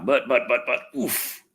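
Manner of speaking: ordinary delivery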